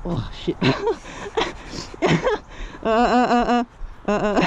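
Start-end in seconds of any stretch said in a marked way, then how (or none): 0.6-2.8 breathy nervous laugh. Real, not acted. After a scary moment.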